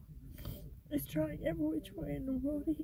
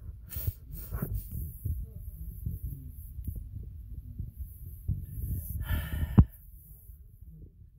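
a long, drawn-out sigh with a rising end; long tired sigh; 11 semitones lower than usual. Real, not acted. A weary believer sighing over setbacks, long tired sigh. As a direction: let it all out in one breath.

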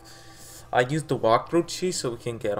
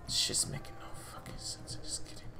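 hushed, exasperated muttering; frustrated whisper; 4 semitones higher, 12 dB quieter, much faster than usual. Real, not acted. Gamer baffled by the game, frustrated whisper. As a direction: whisper it to yourself, fed up.

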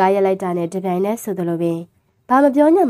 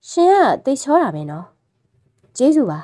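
higher, coy flattering voice; 5 semitones higher than usual. Acted. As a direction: coax him sweetly with flattery. Woman flatters the driver.

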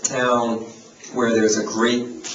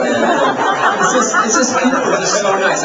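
laughing gush; 8 semitones higher, 5 dB louder than usual. Real, not acted. Gushing about friendly Evanston people.

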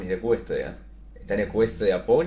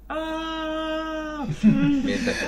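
high squeal; 4 dB louder, much slower than usual. Acted. Reader squeals mid-story.

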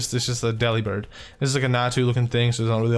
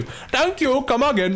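very high, silly put-on voice; comic shopkeeper catchphrase; 12 semitones higher, slower than usual. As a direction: squeal the thanks like a cartoon shopkeeper. Repeated thanks turns into comic catchphrase.

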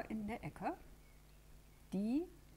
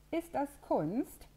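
much higher, slow, innocent question; clueless rabbit; 9 semitones higher, 7 dB louder, slower than usual. Acted. The rabbit asks the others naively about the junk, clueless. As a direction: ask it wide-eyed and clueless. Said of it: "fragt das Kaninchen ahnungslos".

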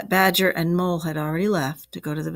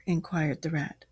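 plain reading voice

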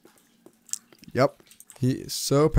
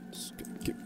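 whispering; 19 dB quieter, faster than usual. Real, not acted. Resigned aside under breath.

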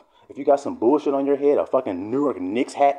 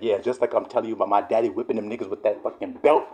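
usual speaking voice

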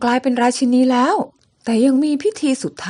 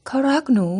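normal talking voice

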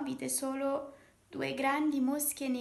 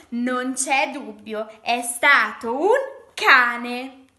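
very loud, drawn-out proclamation; emphatic verdict; 3 semitones higher, 16 dB louder, much slower than usual. Acted. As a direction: announce it slowly at full volume, word by word. Flies announce their verdict. (sentenza proclamata a gran voce)

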